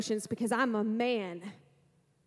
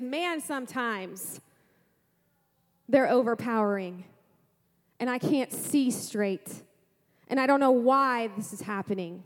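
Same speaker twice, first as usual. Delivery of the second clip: ordinary talk, nothing marked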